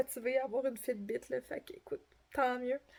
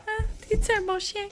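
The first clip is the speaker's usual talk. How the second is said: talking to a dog; 9 semitones higher, 4 dB louder than usual. Real, not acted. Asking her dog.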